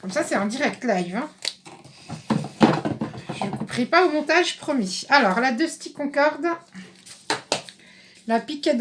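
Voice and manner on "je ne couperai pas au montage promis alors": usual speaking voice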